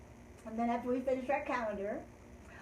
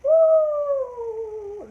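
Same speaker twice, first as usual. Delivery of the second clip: high loud whoosh of breath; loud woo as a breath release; 12 semitones higher, 13 dB louder than usual. Shown as a demonstration. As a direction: blow the breath out hard. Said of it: "Take a deep breath".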